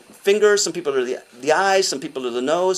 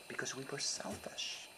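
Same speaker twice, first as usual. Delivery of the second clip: hushed confession; 2 semitones lower, 20 dB quieter than usual. Real, not acted. Confessing his generation's sin.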